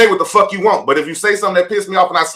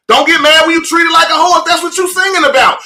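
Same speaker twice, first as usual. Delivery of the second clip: yelling across the room; 8 semitones higher than usual. Real, not acted. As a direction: project it like calling across a room. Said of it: "It sound like he yelling from across the room".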